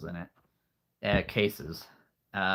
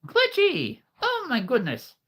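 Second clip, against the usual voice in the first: high, squealing call of a viewer's name; excited greeting; 4 dB louder than usual. Real, not acted. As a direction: greet the viewer with a burst of delight.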